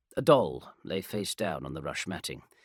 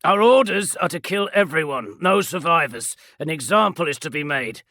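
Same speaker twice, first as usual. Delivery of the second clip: loud, raised voice of command; hard sergeant; 6 semitones higher, 10 dB louder than usual. Acted. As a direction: lay down the kill order. Sergeant voice, held orders.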